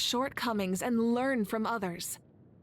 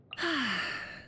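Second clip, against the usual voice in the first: wistful sigh; 3 semitones higher than usual. Acted. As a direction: let the thought of the past weigh a little. Wistful sigh about ruins.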